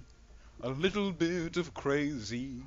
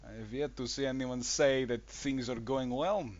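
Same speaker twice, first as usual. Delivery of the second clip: ordinary talk, nothing marked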